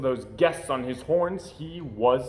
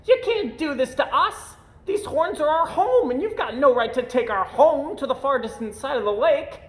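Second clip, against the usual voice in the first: high, loud protesting crowd; high loud squabbling guests; indignant guests; 12 semitones higher, 5 dB louder than usual. Acted. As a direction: complain like little creatures losing their home.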